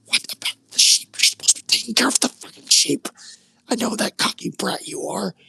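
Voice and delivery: high, loud scolding rant; big brother yelling at David for leaving the sheep; angry older brother. Acted. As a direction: tear into your little brother. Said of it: "he demanded".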